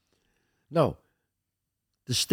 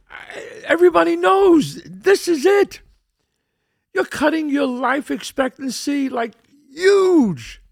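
raised, high-pitched exasperated outburst at a friend; incredulous scolding; 11 semitones higher, 5 dB louder than usual. Retold or imitated. Man confronting his friend over smoking, incredulous scolding. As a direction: build from a disbelieving question to a loud high cry.

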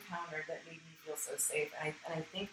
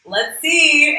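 higher, louder teasing challenge; mock universe; 9 semitones higher, 17 dB louder than usual. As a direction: voice the universe daring her, playful. The universe testing her.

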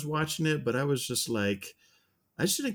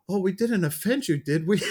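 higher-pitched mock queen's question; retold queen, pitched up; lightly voiced queen; 7 semitones higher, 3 dB louder than usual. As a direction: lightly put on a queen checking for offence. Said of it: "the queen says something like".

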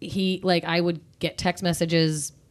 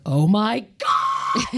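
slow, drawn-out alarmed gasp of words; seeing missed calls from all siblings, slow alarmed exclamation; alarmed oh my God; 5 semitones higher, 4 dB louder, much slower than usual. Retold or imitated. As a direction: stretch it out in dread.

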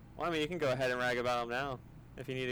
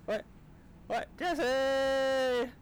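much higher, louder desperate yell; anguished shout; 12 semitones higher, 6 dB louder, much slower than usual. Acted. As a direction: cry out the name in despair. Skit, AI abandons the crew.

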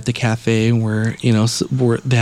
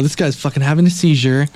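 usual speaking voice

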